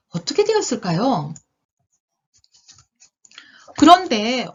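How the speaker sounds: animated, suspenseful storybook narration; children's storytelling. A narrator's reading. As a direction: draw young listeners into each turn.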